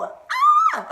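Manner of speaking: very high, loud squeal; excited scream. Real, not acted. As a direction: let out a piercing excited shriek. Excited outburst, then apologizes.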